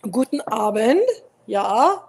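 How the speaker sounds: put-on loud, hearty go at a German accent. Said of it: "I do the accent very well".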